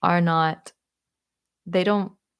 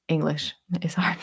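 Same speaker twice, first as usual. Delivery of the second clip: whisper. Real, not acted. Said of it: "whispered aside".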